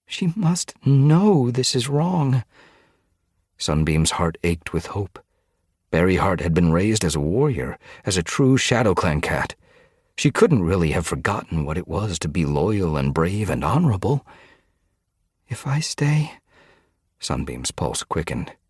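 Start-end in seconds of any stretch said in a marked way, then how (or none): 0.0-2.4 high, slow, pressing insistence; urgent disbelief. A narrator's reading. Sunbeam aching over Berryheart, urgent disbelief. Cat's anxious thought in a story. 15.5-16.3 high, breath-only whisper; whispered thought. A narrator's reading. Sunbeam thinking of staying to save her, whispered. Hushed inner thought of a character.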